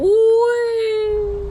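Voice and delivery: high, loud squeal of delight; playful high shriek; gleeful whee. Real, not acted. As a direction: let out a joyful squeal. Drives off the edge gleefully.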